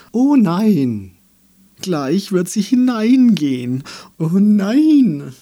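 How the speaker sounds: high, loud wailing alarm; hammed-up cries of dismay; panicked trees. A narrator's reading. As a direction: wail in rising theatrical panic.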